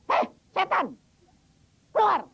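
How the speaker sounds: high, loud command at the demon; preacher ordering a demon to leave, high loud rebuke; rebuking the demon. Retold or imitated. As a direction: cast the spirit out, loud and high.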